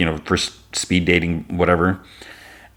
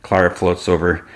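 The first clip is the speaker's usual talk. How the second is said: normal talking voice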